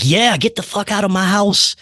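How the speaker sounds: a nasty presence claiming the house; hostile spirit voice. Retold or imitated. Angry spirit ordering him out, pitched high and snarling. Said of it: "They're like".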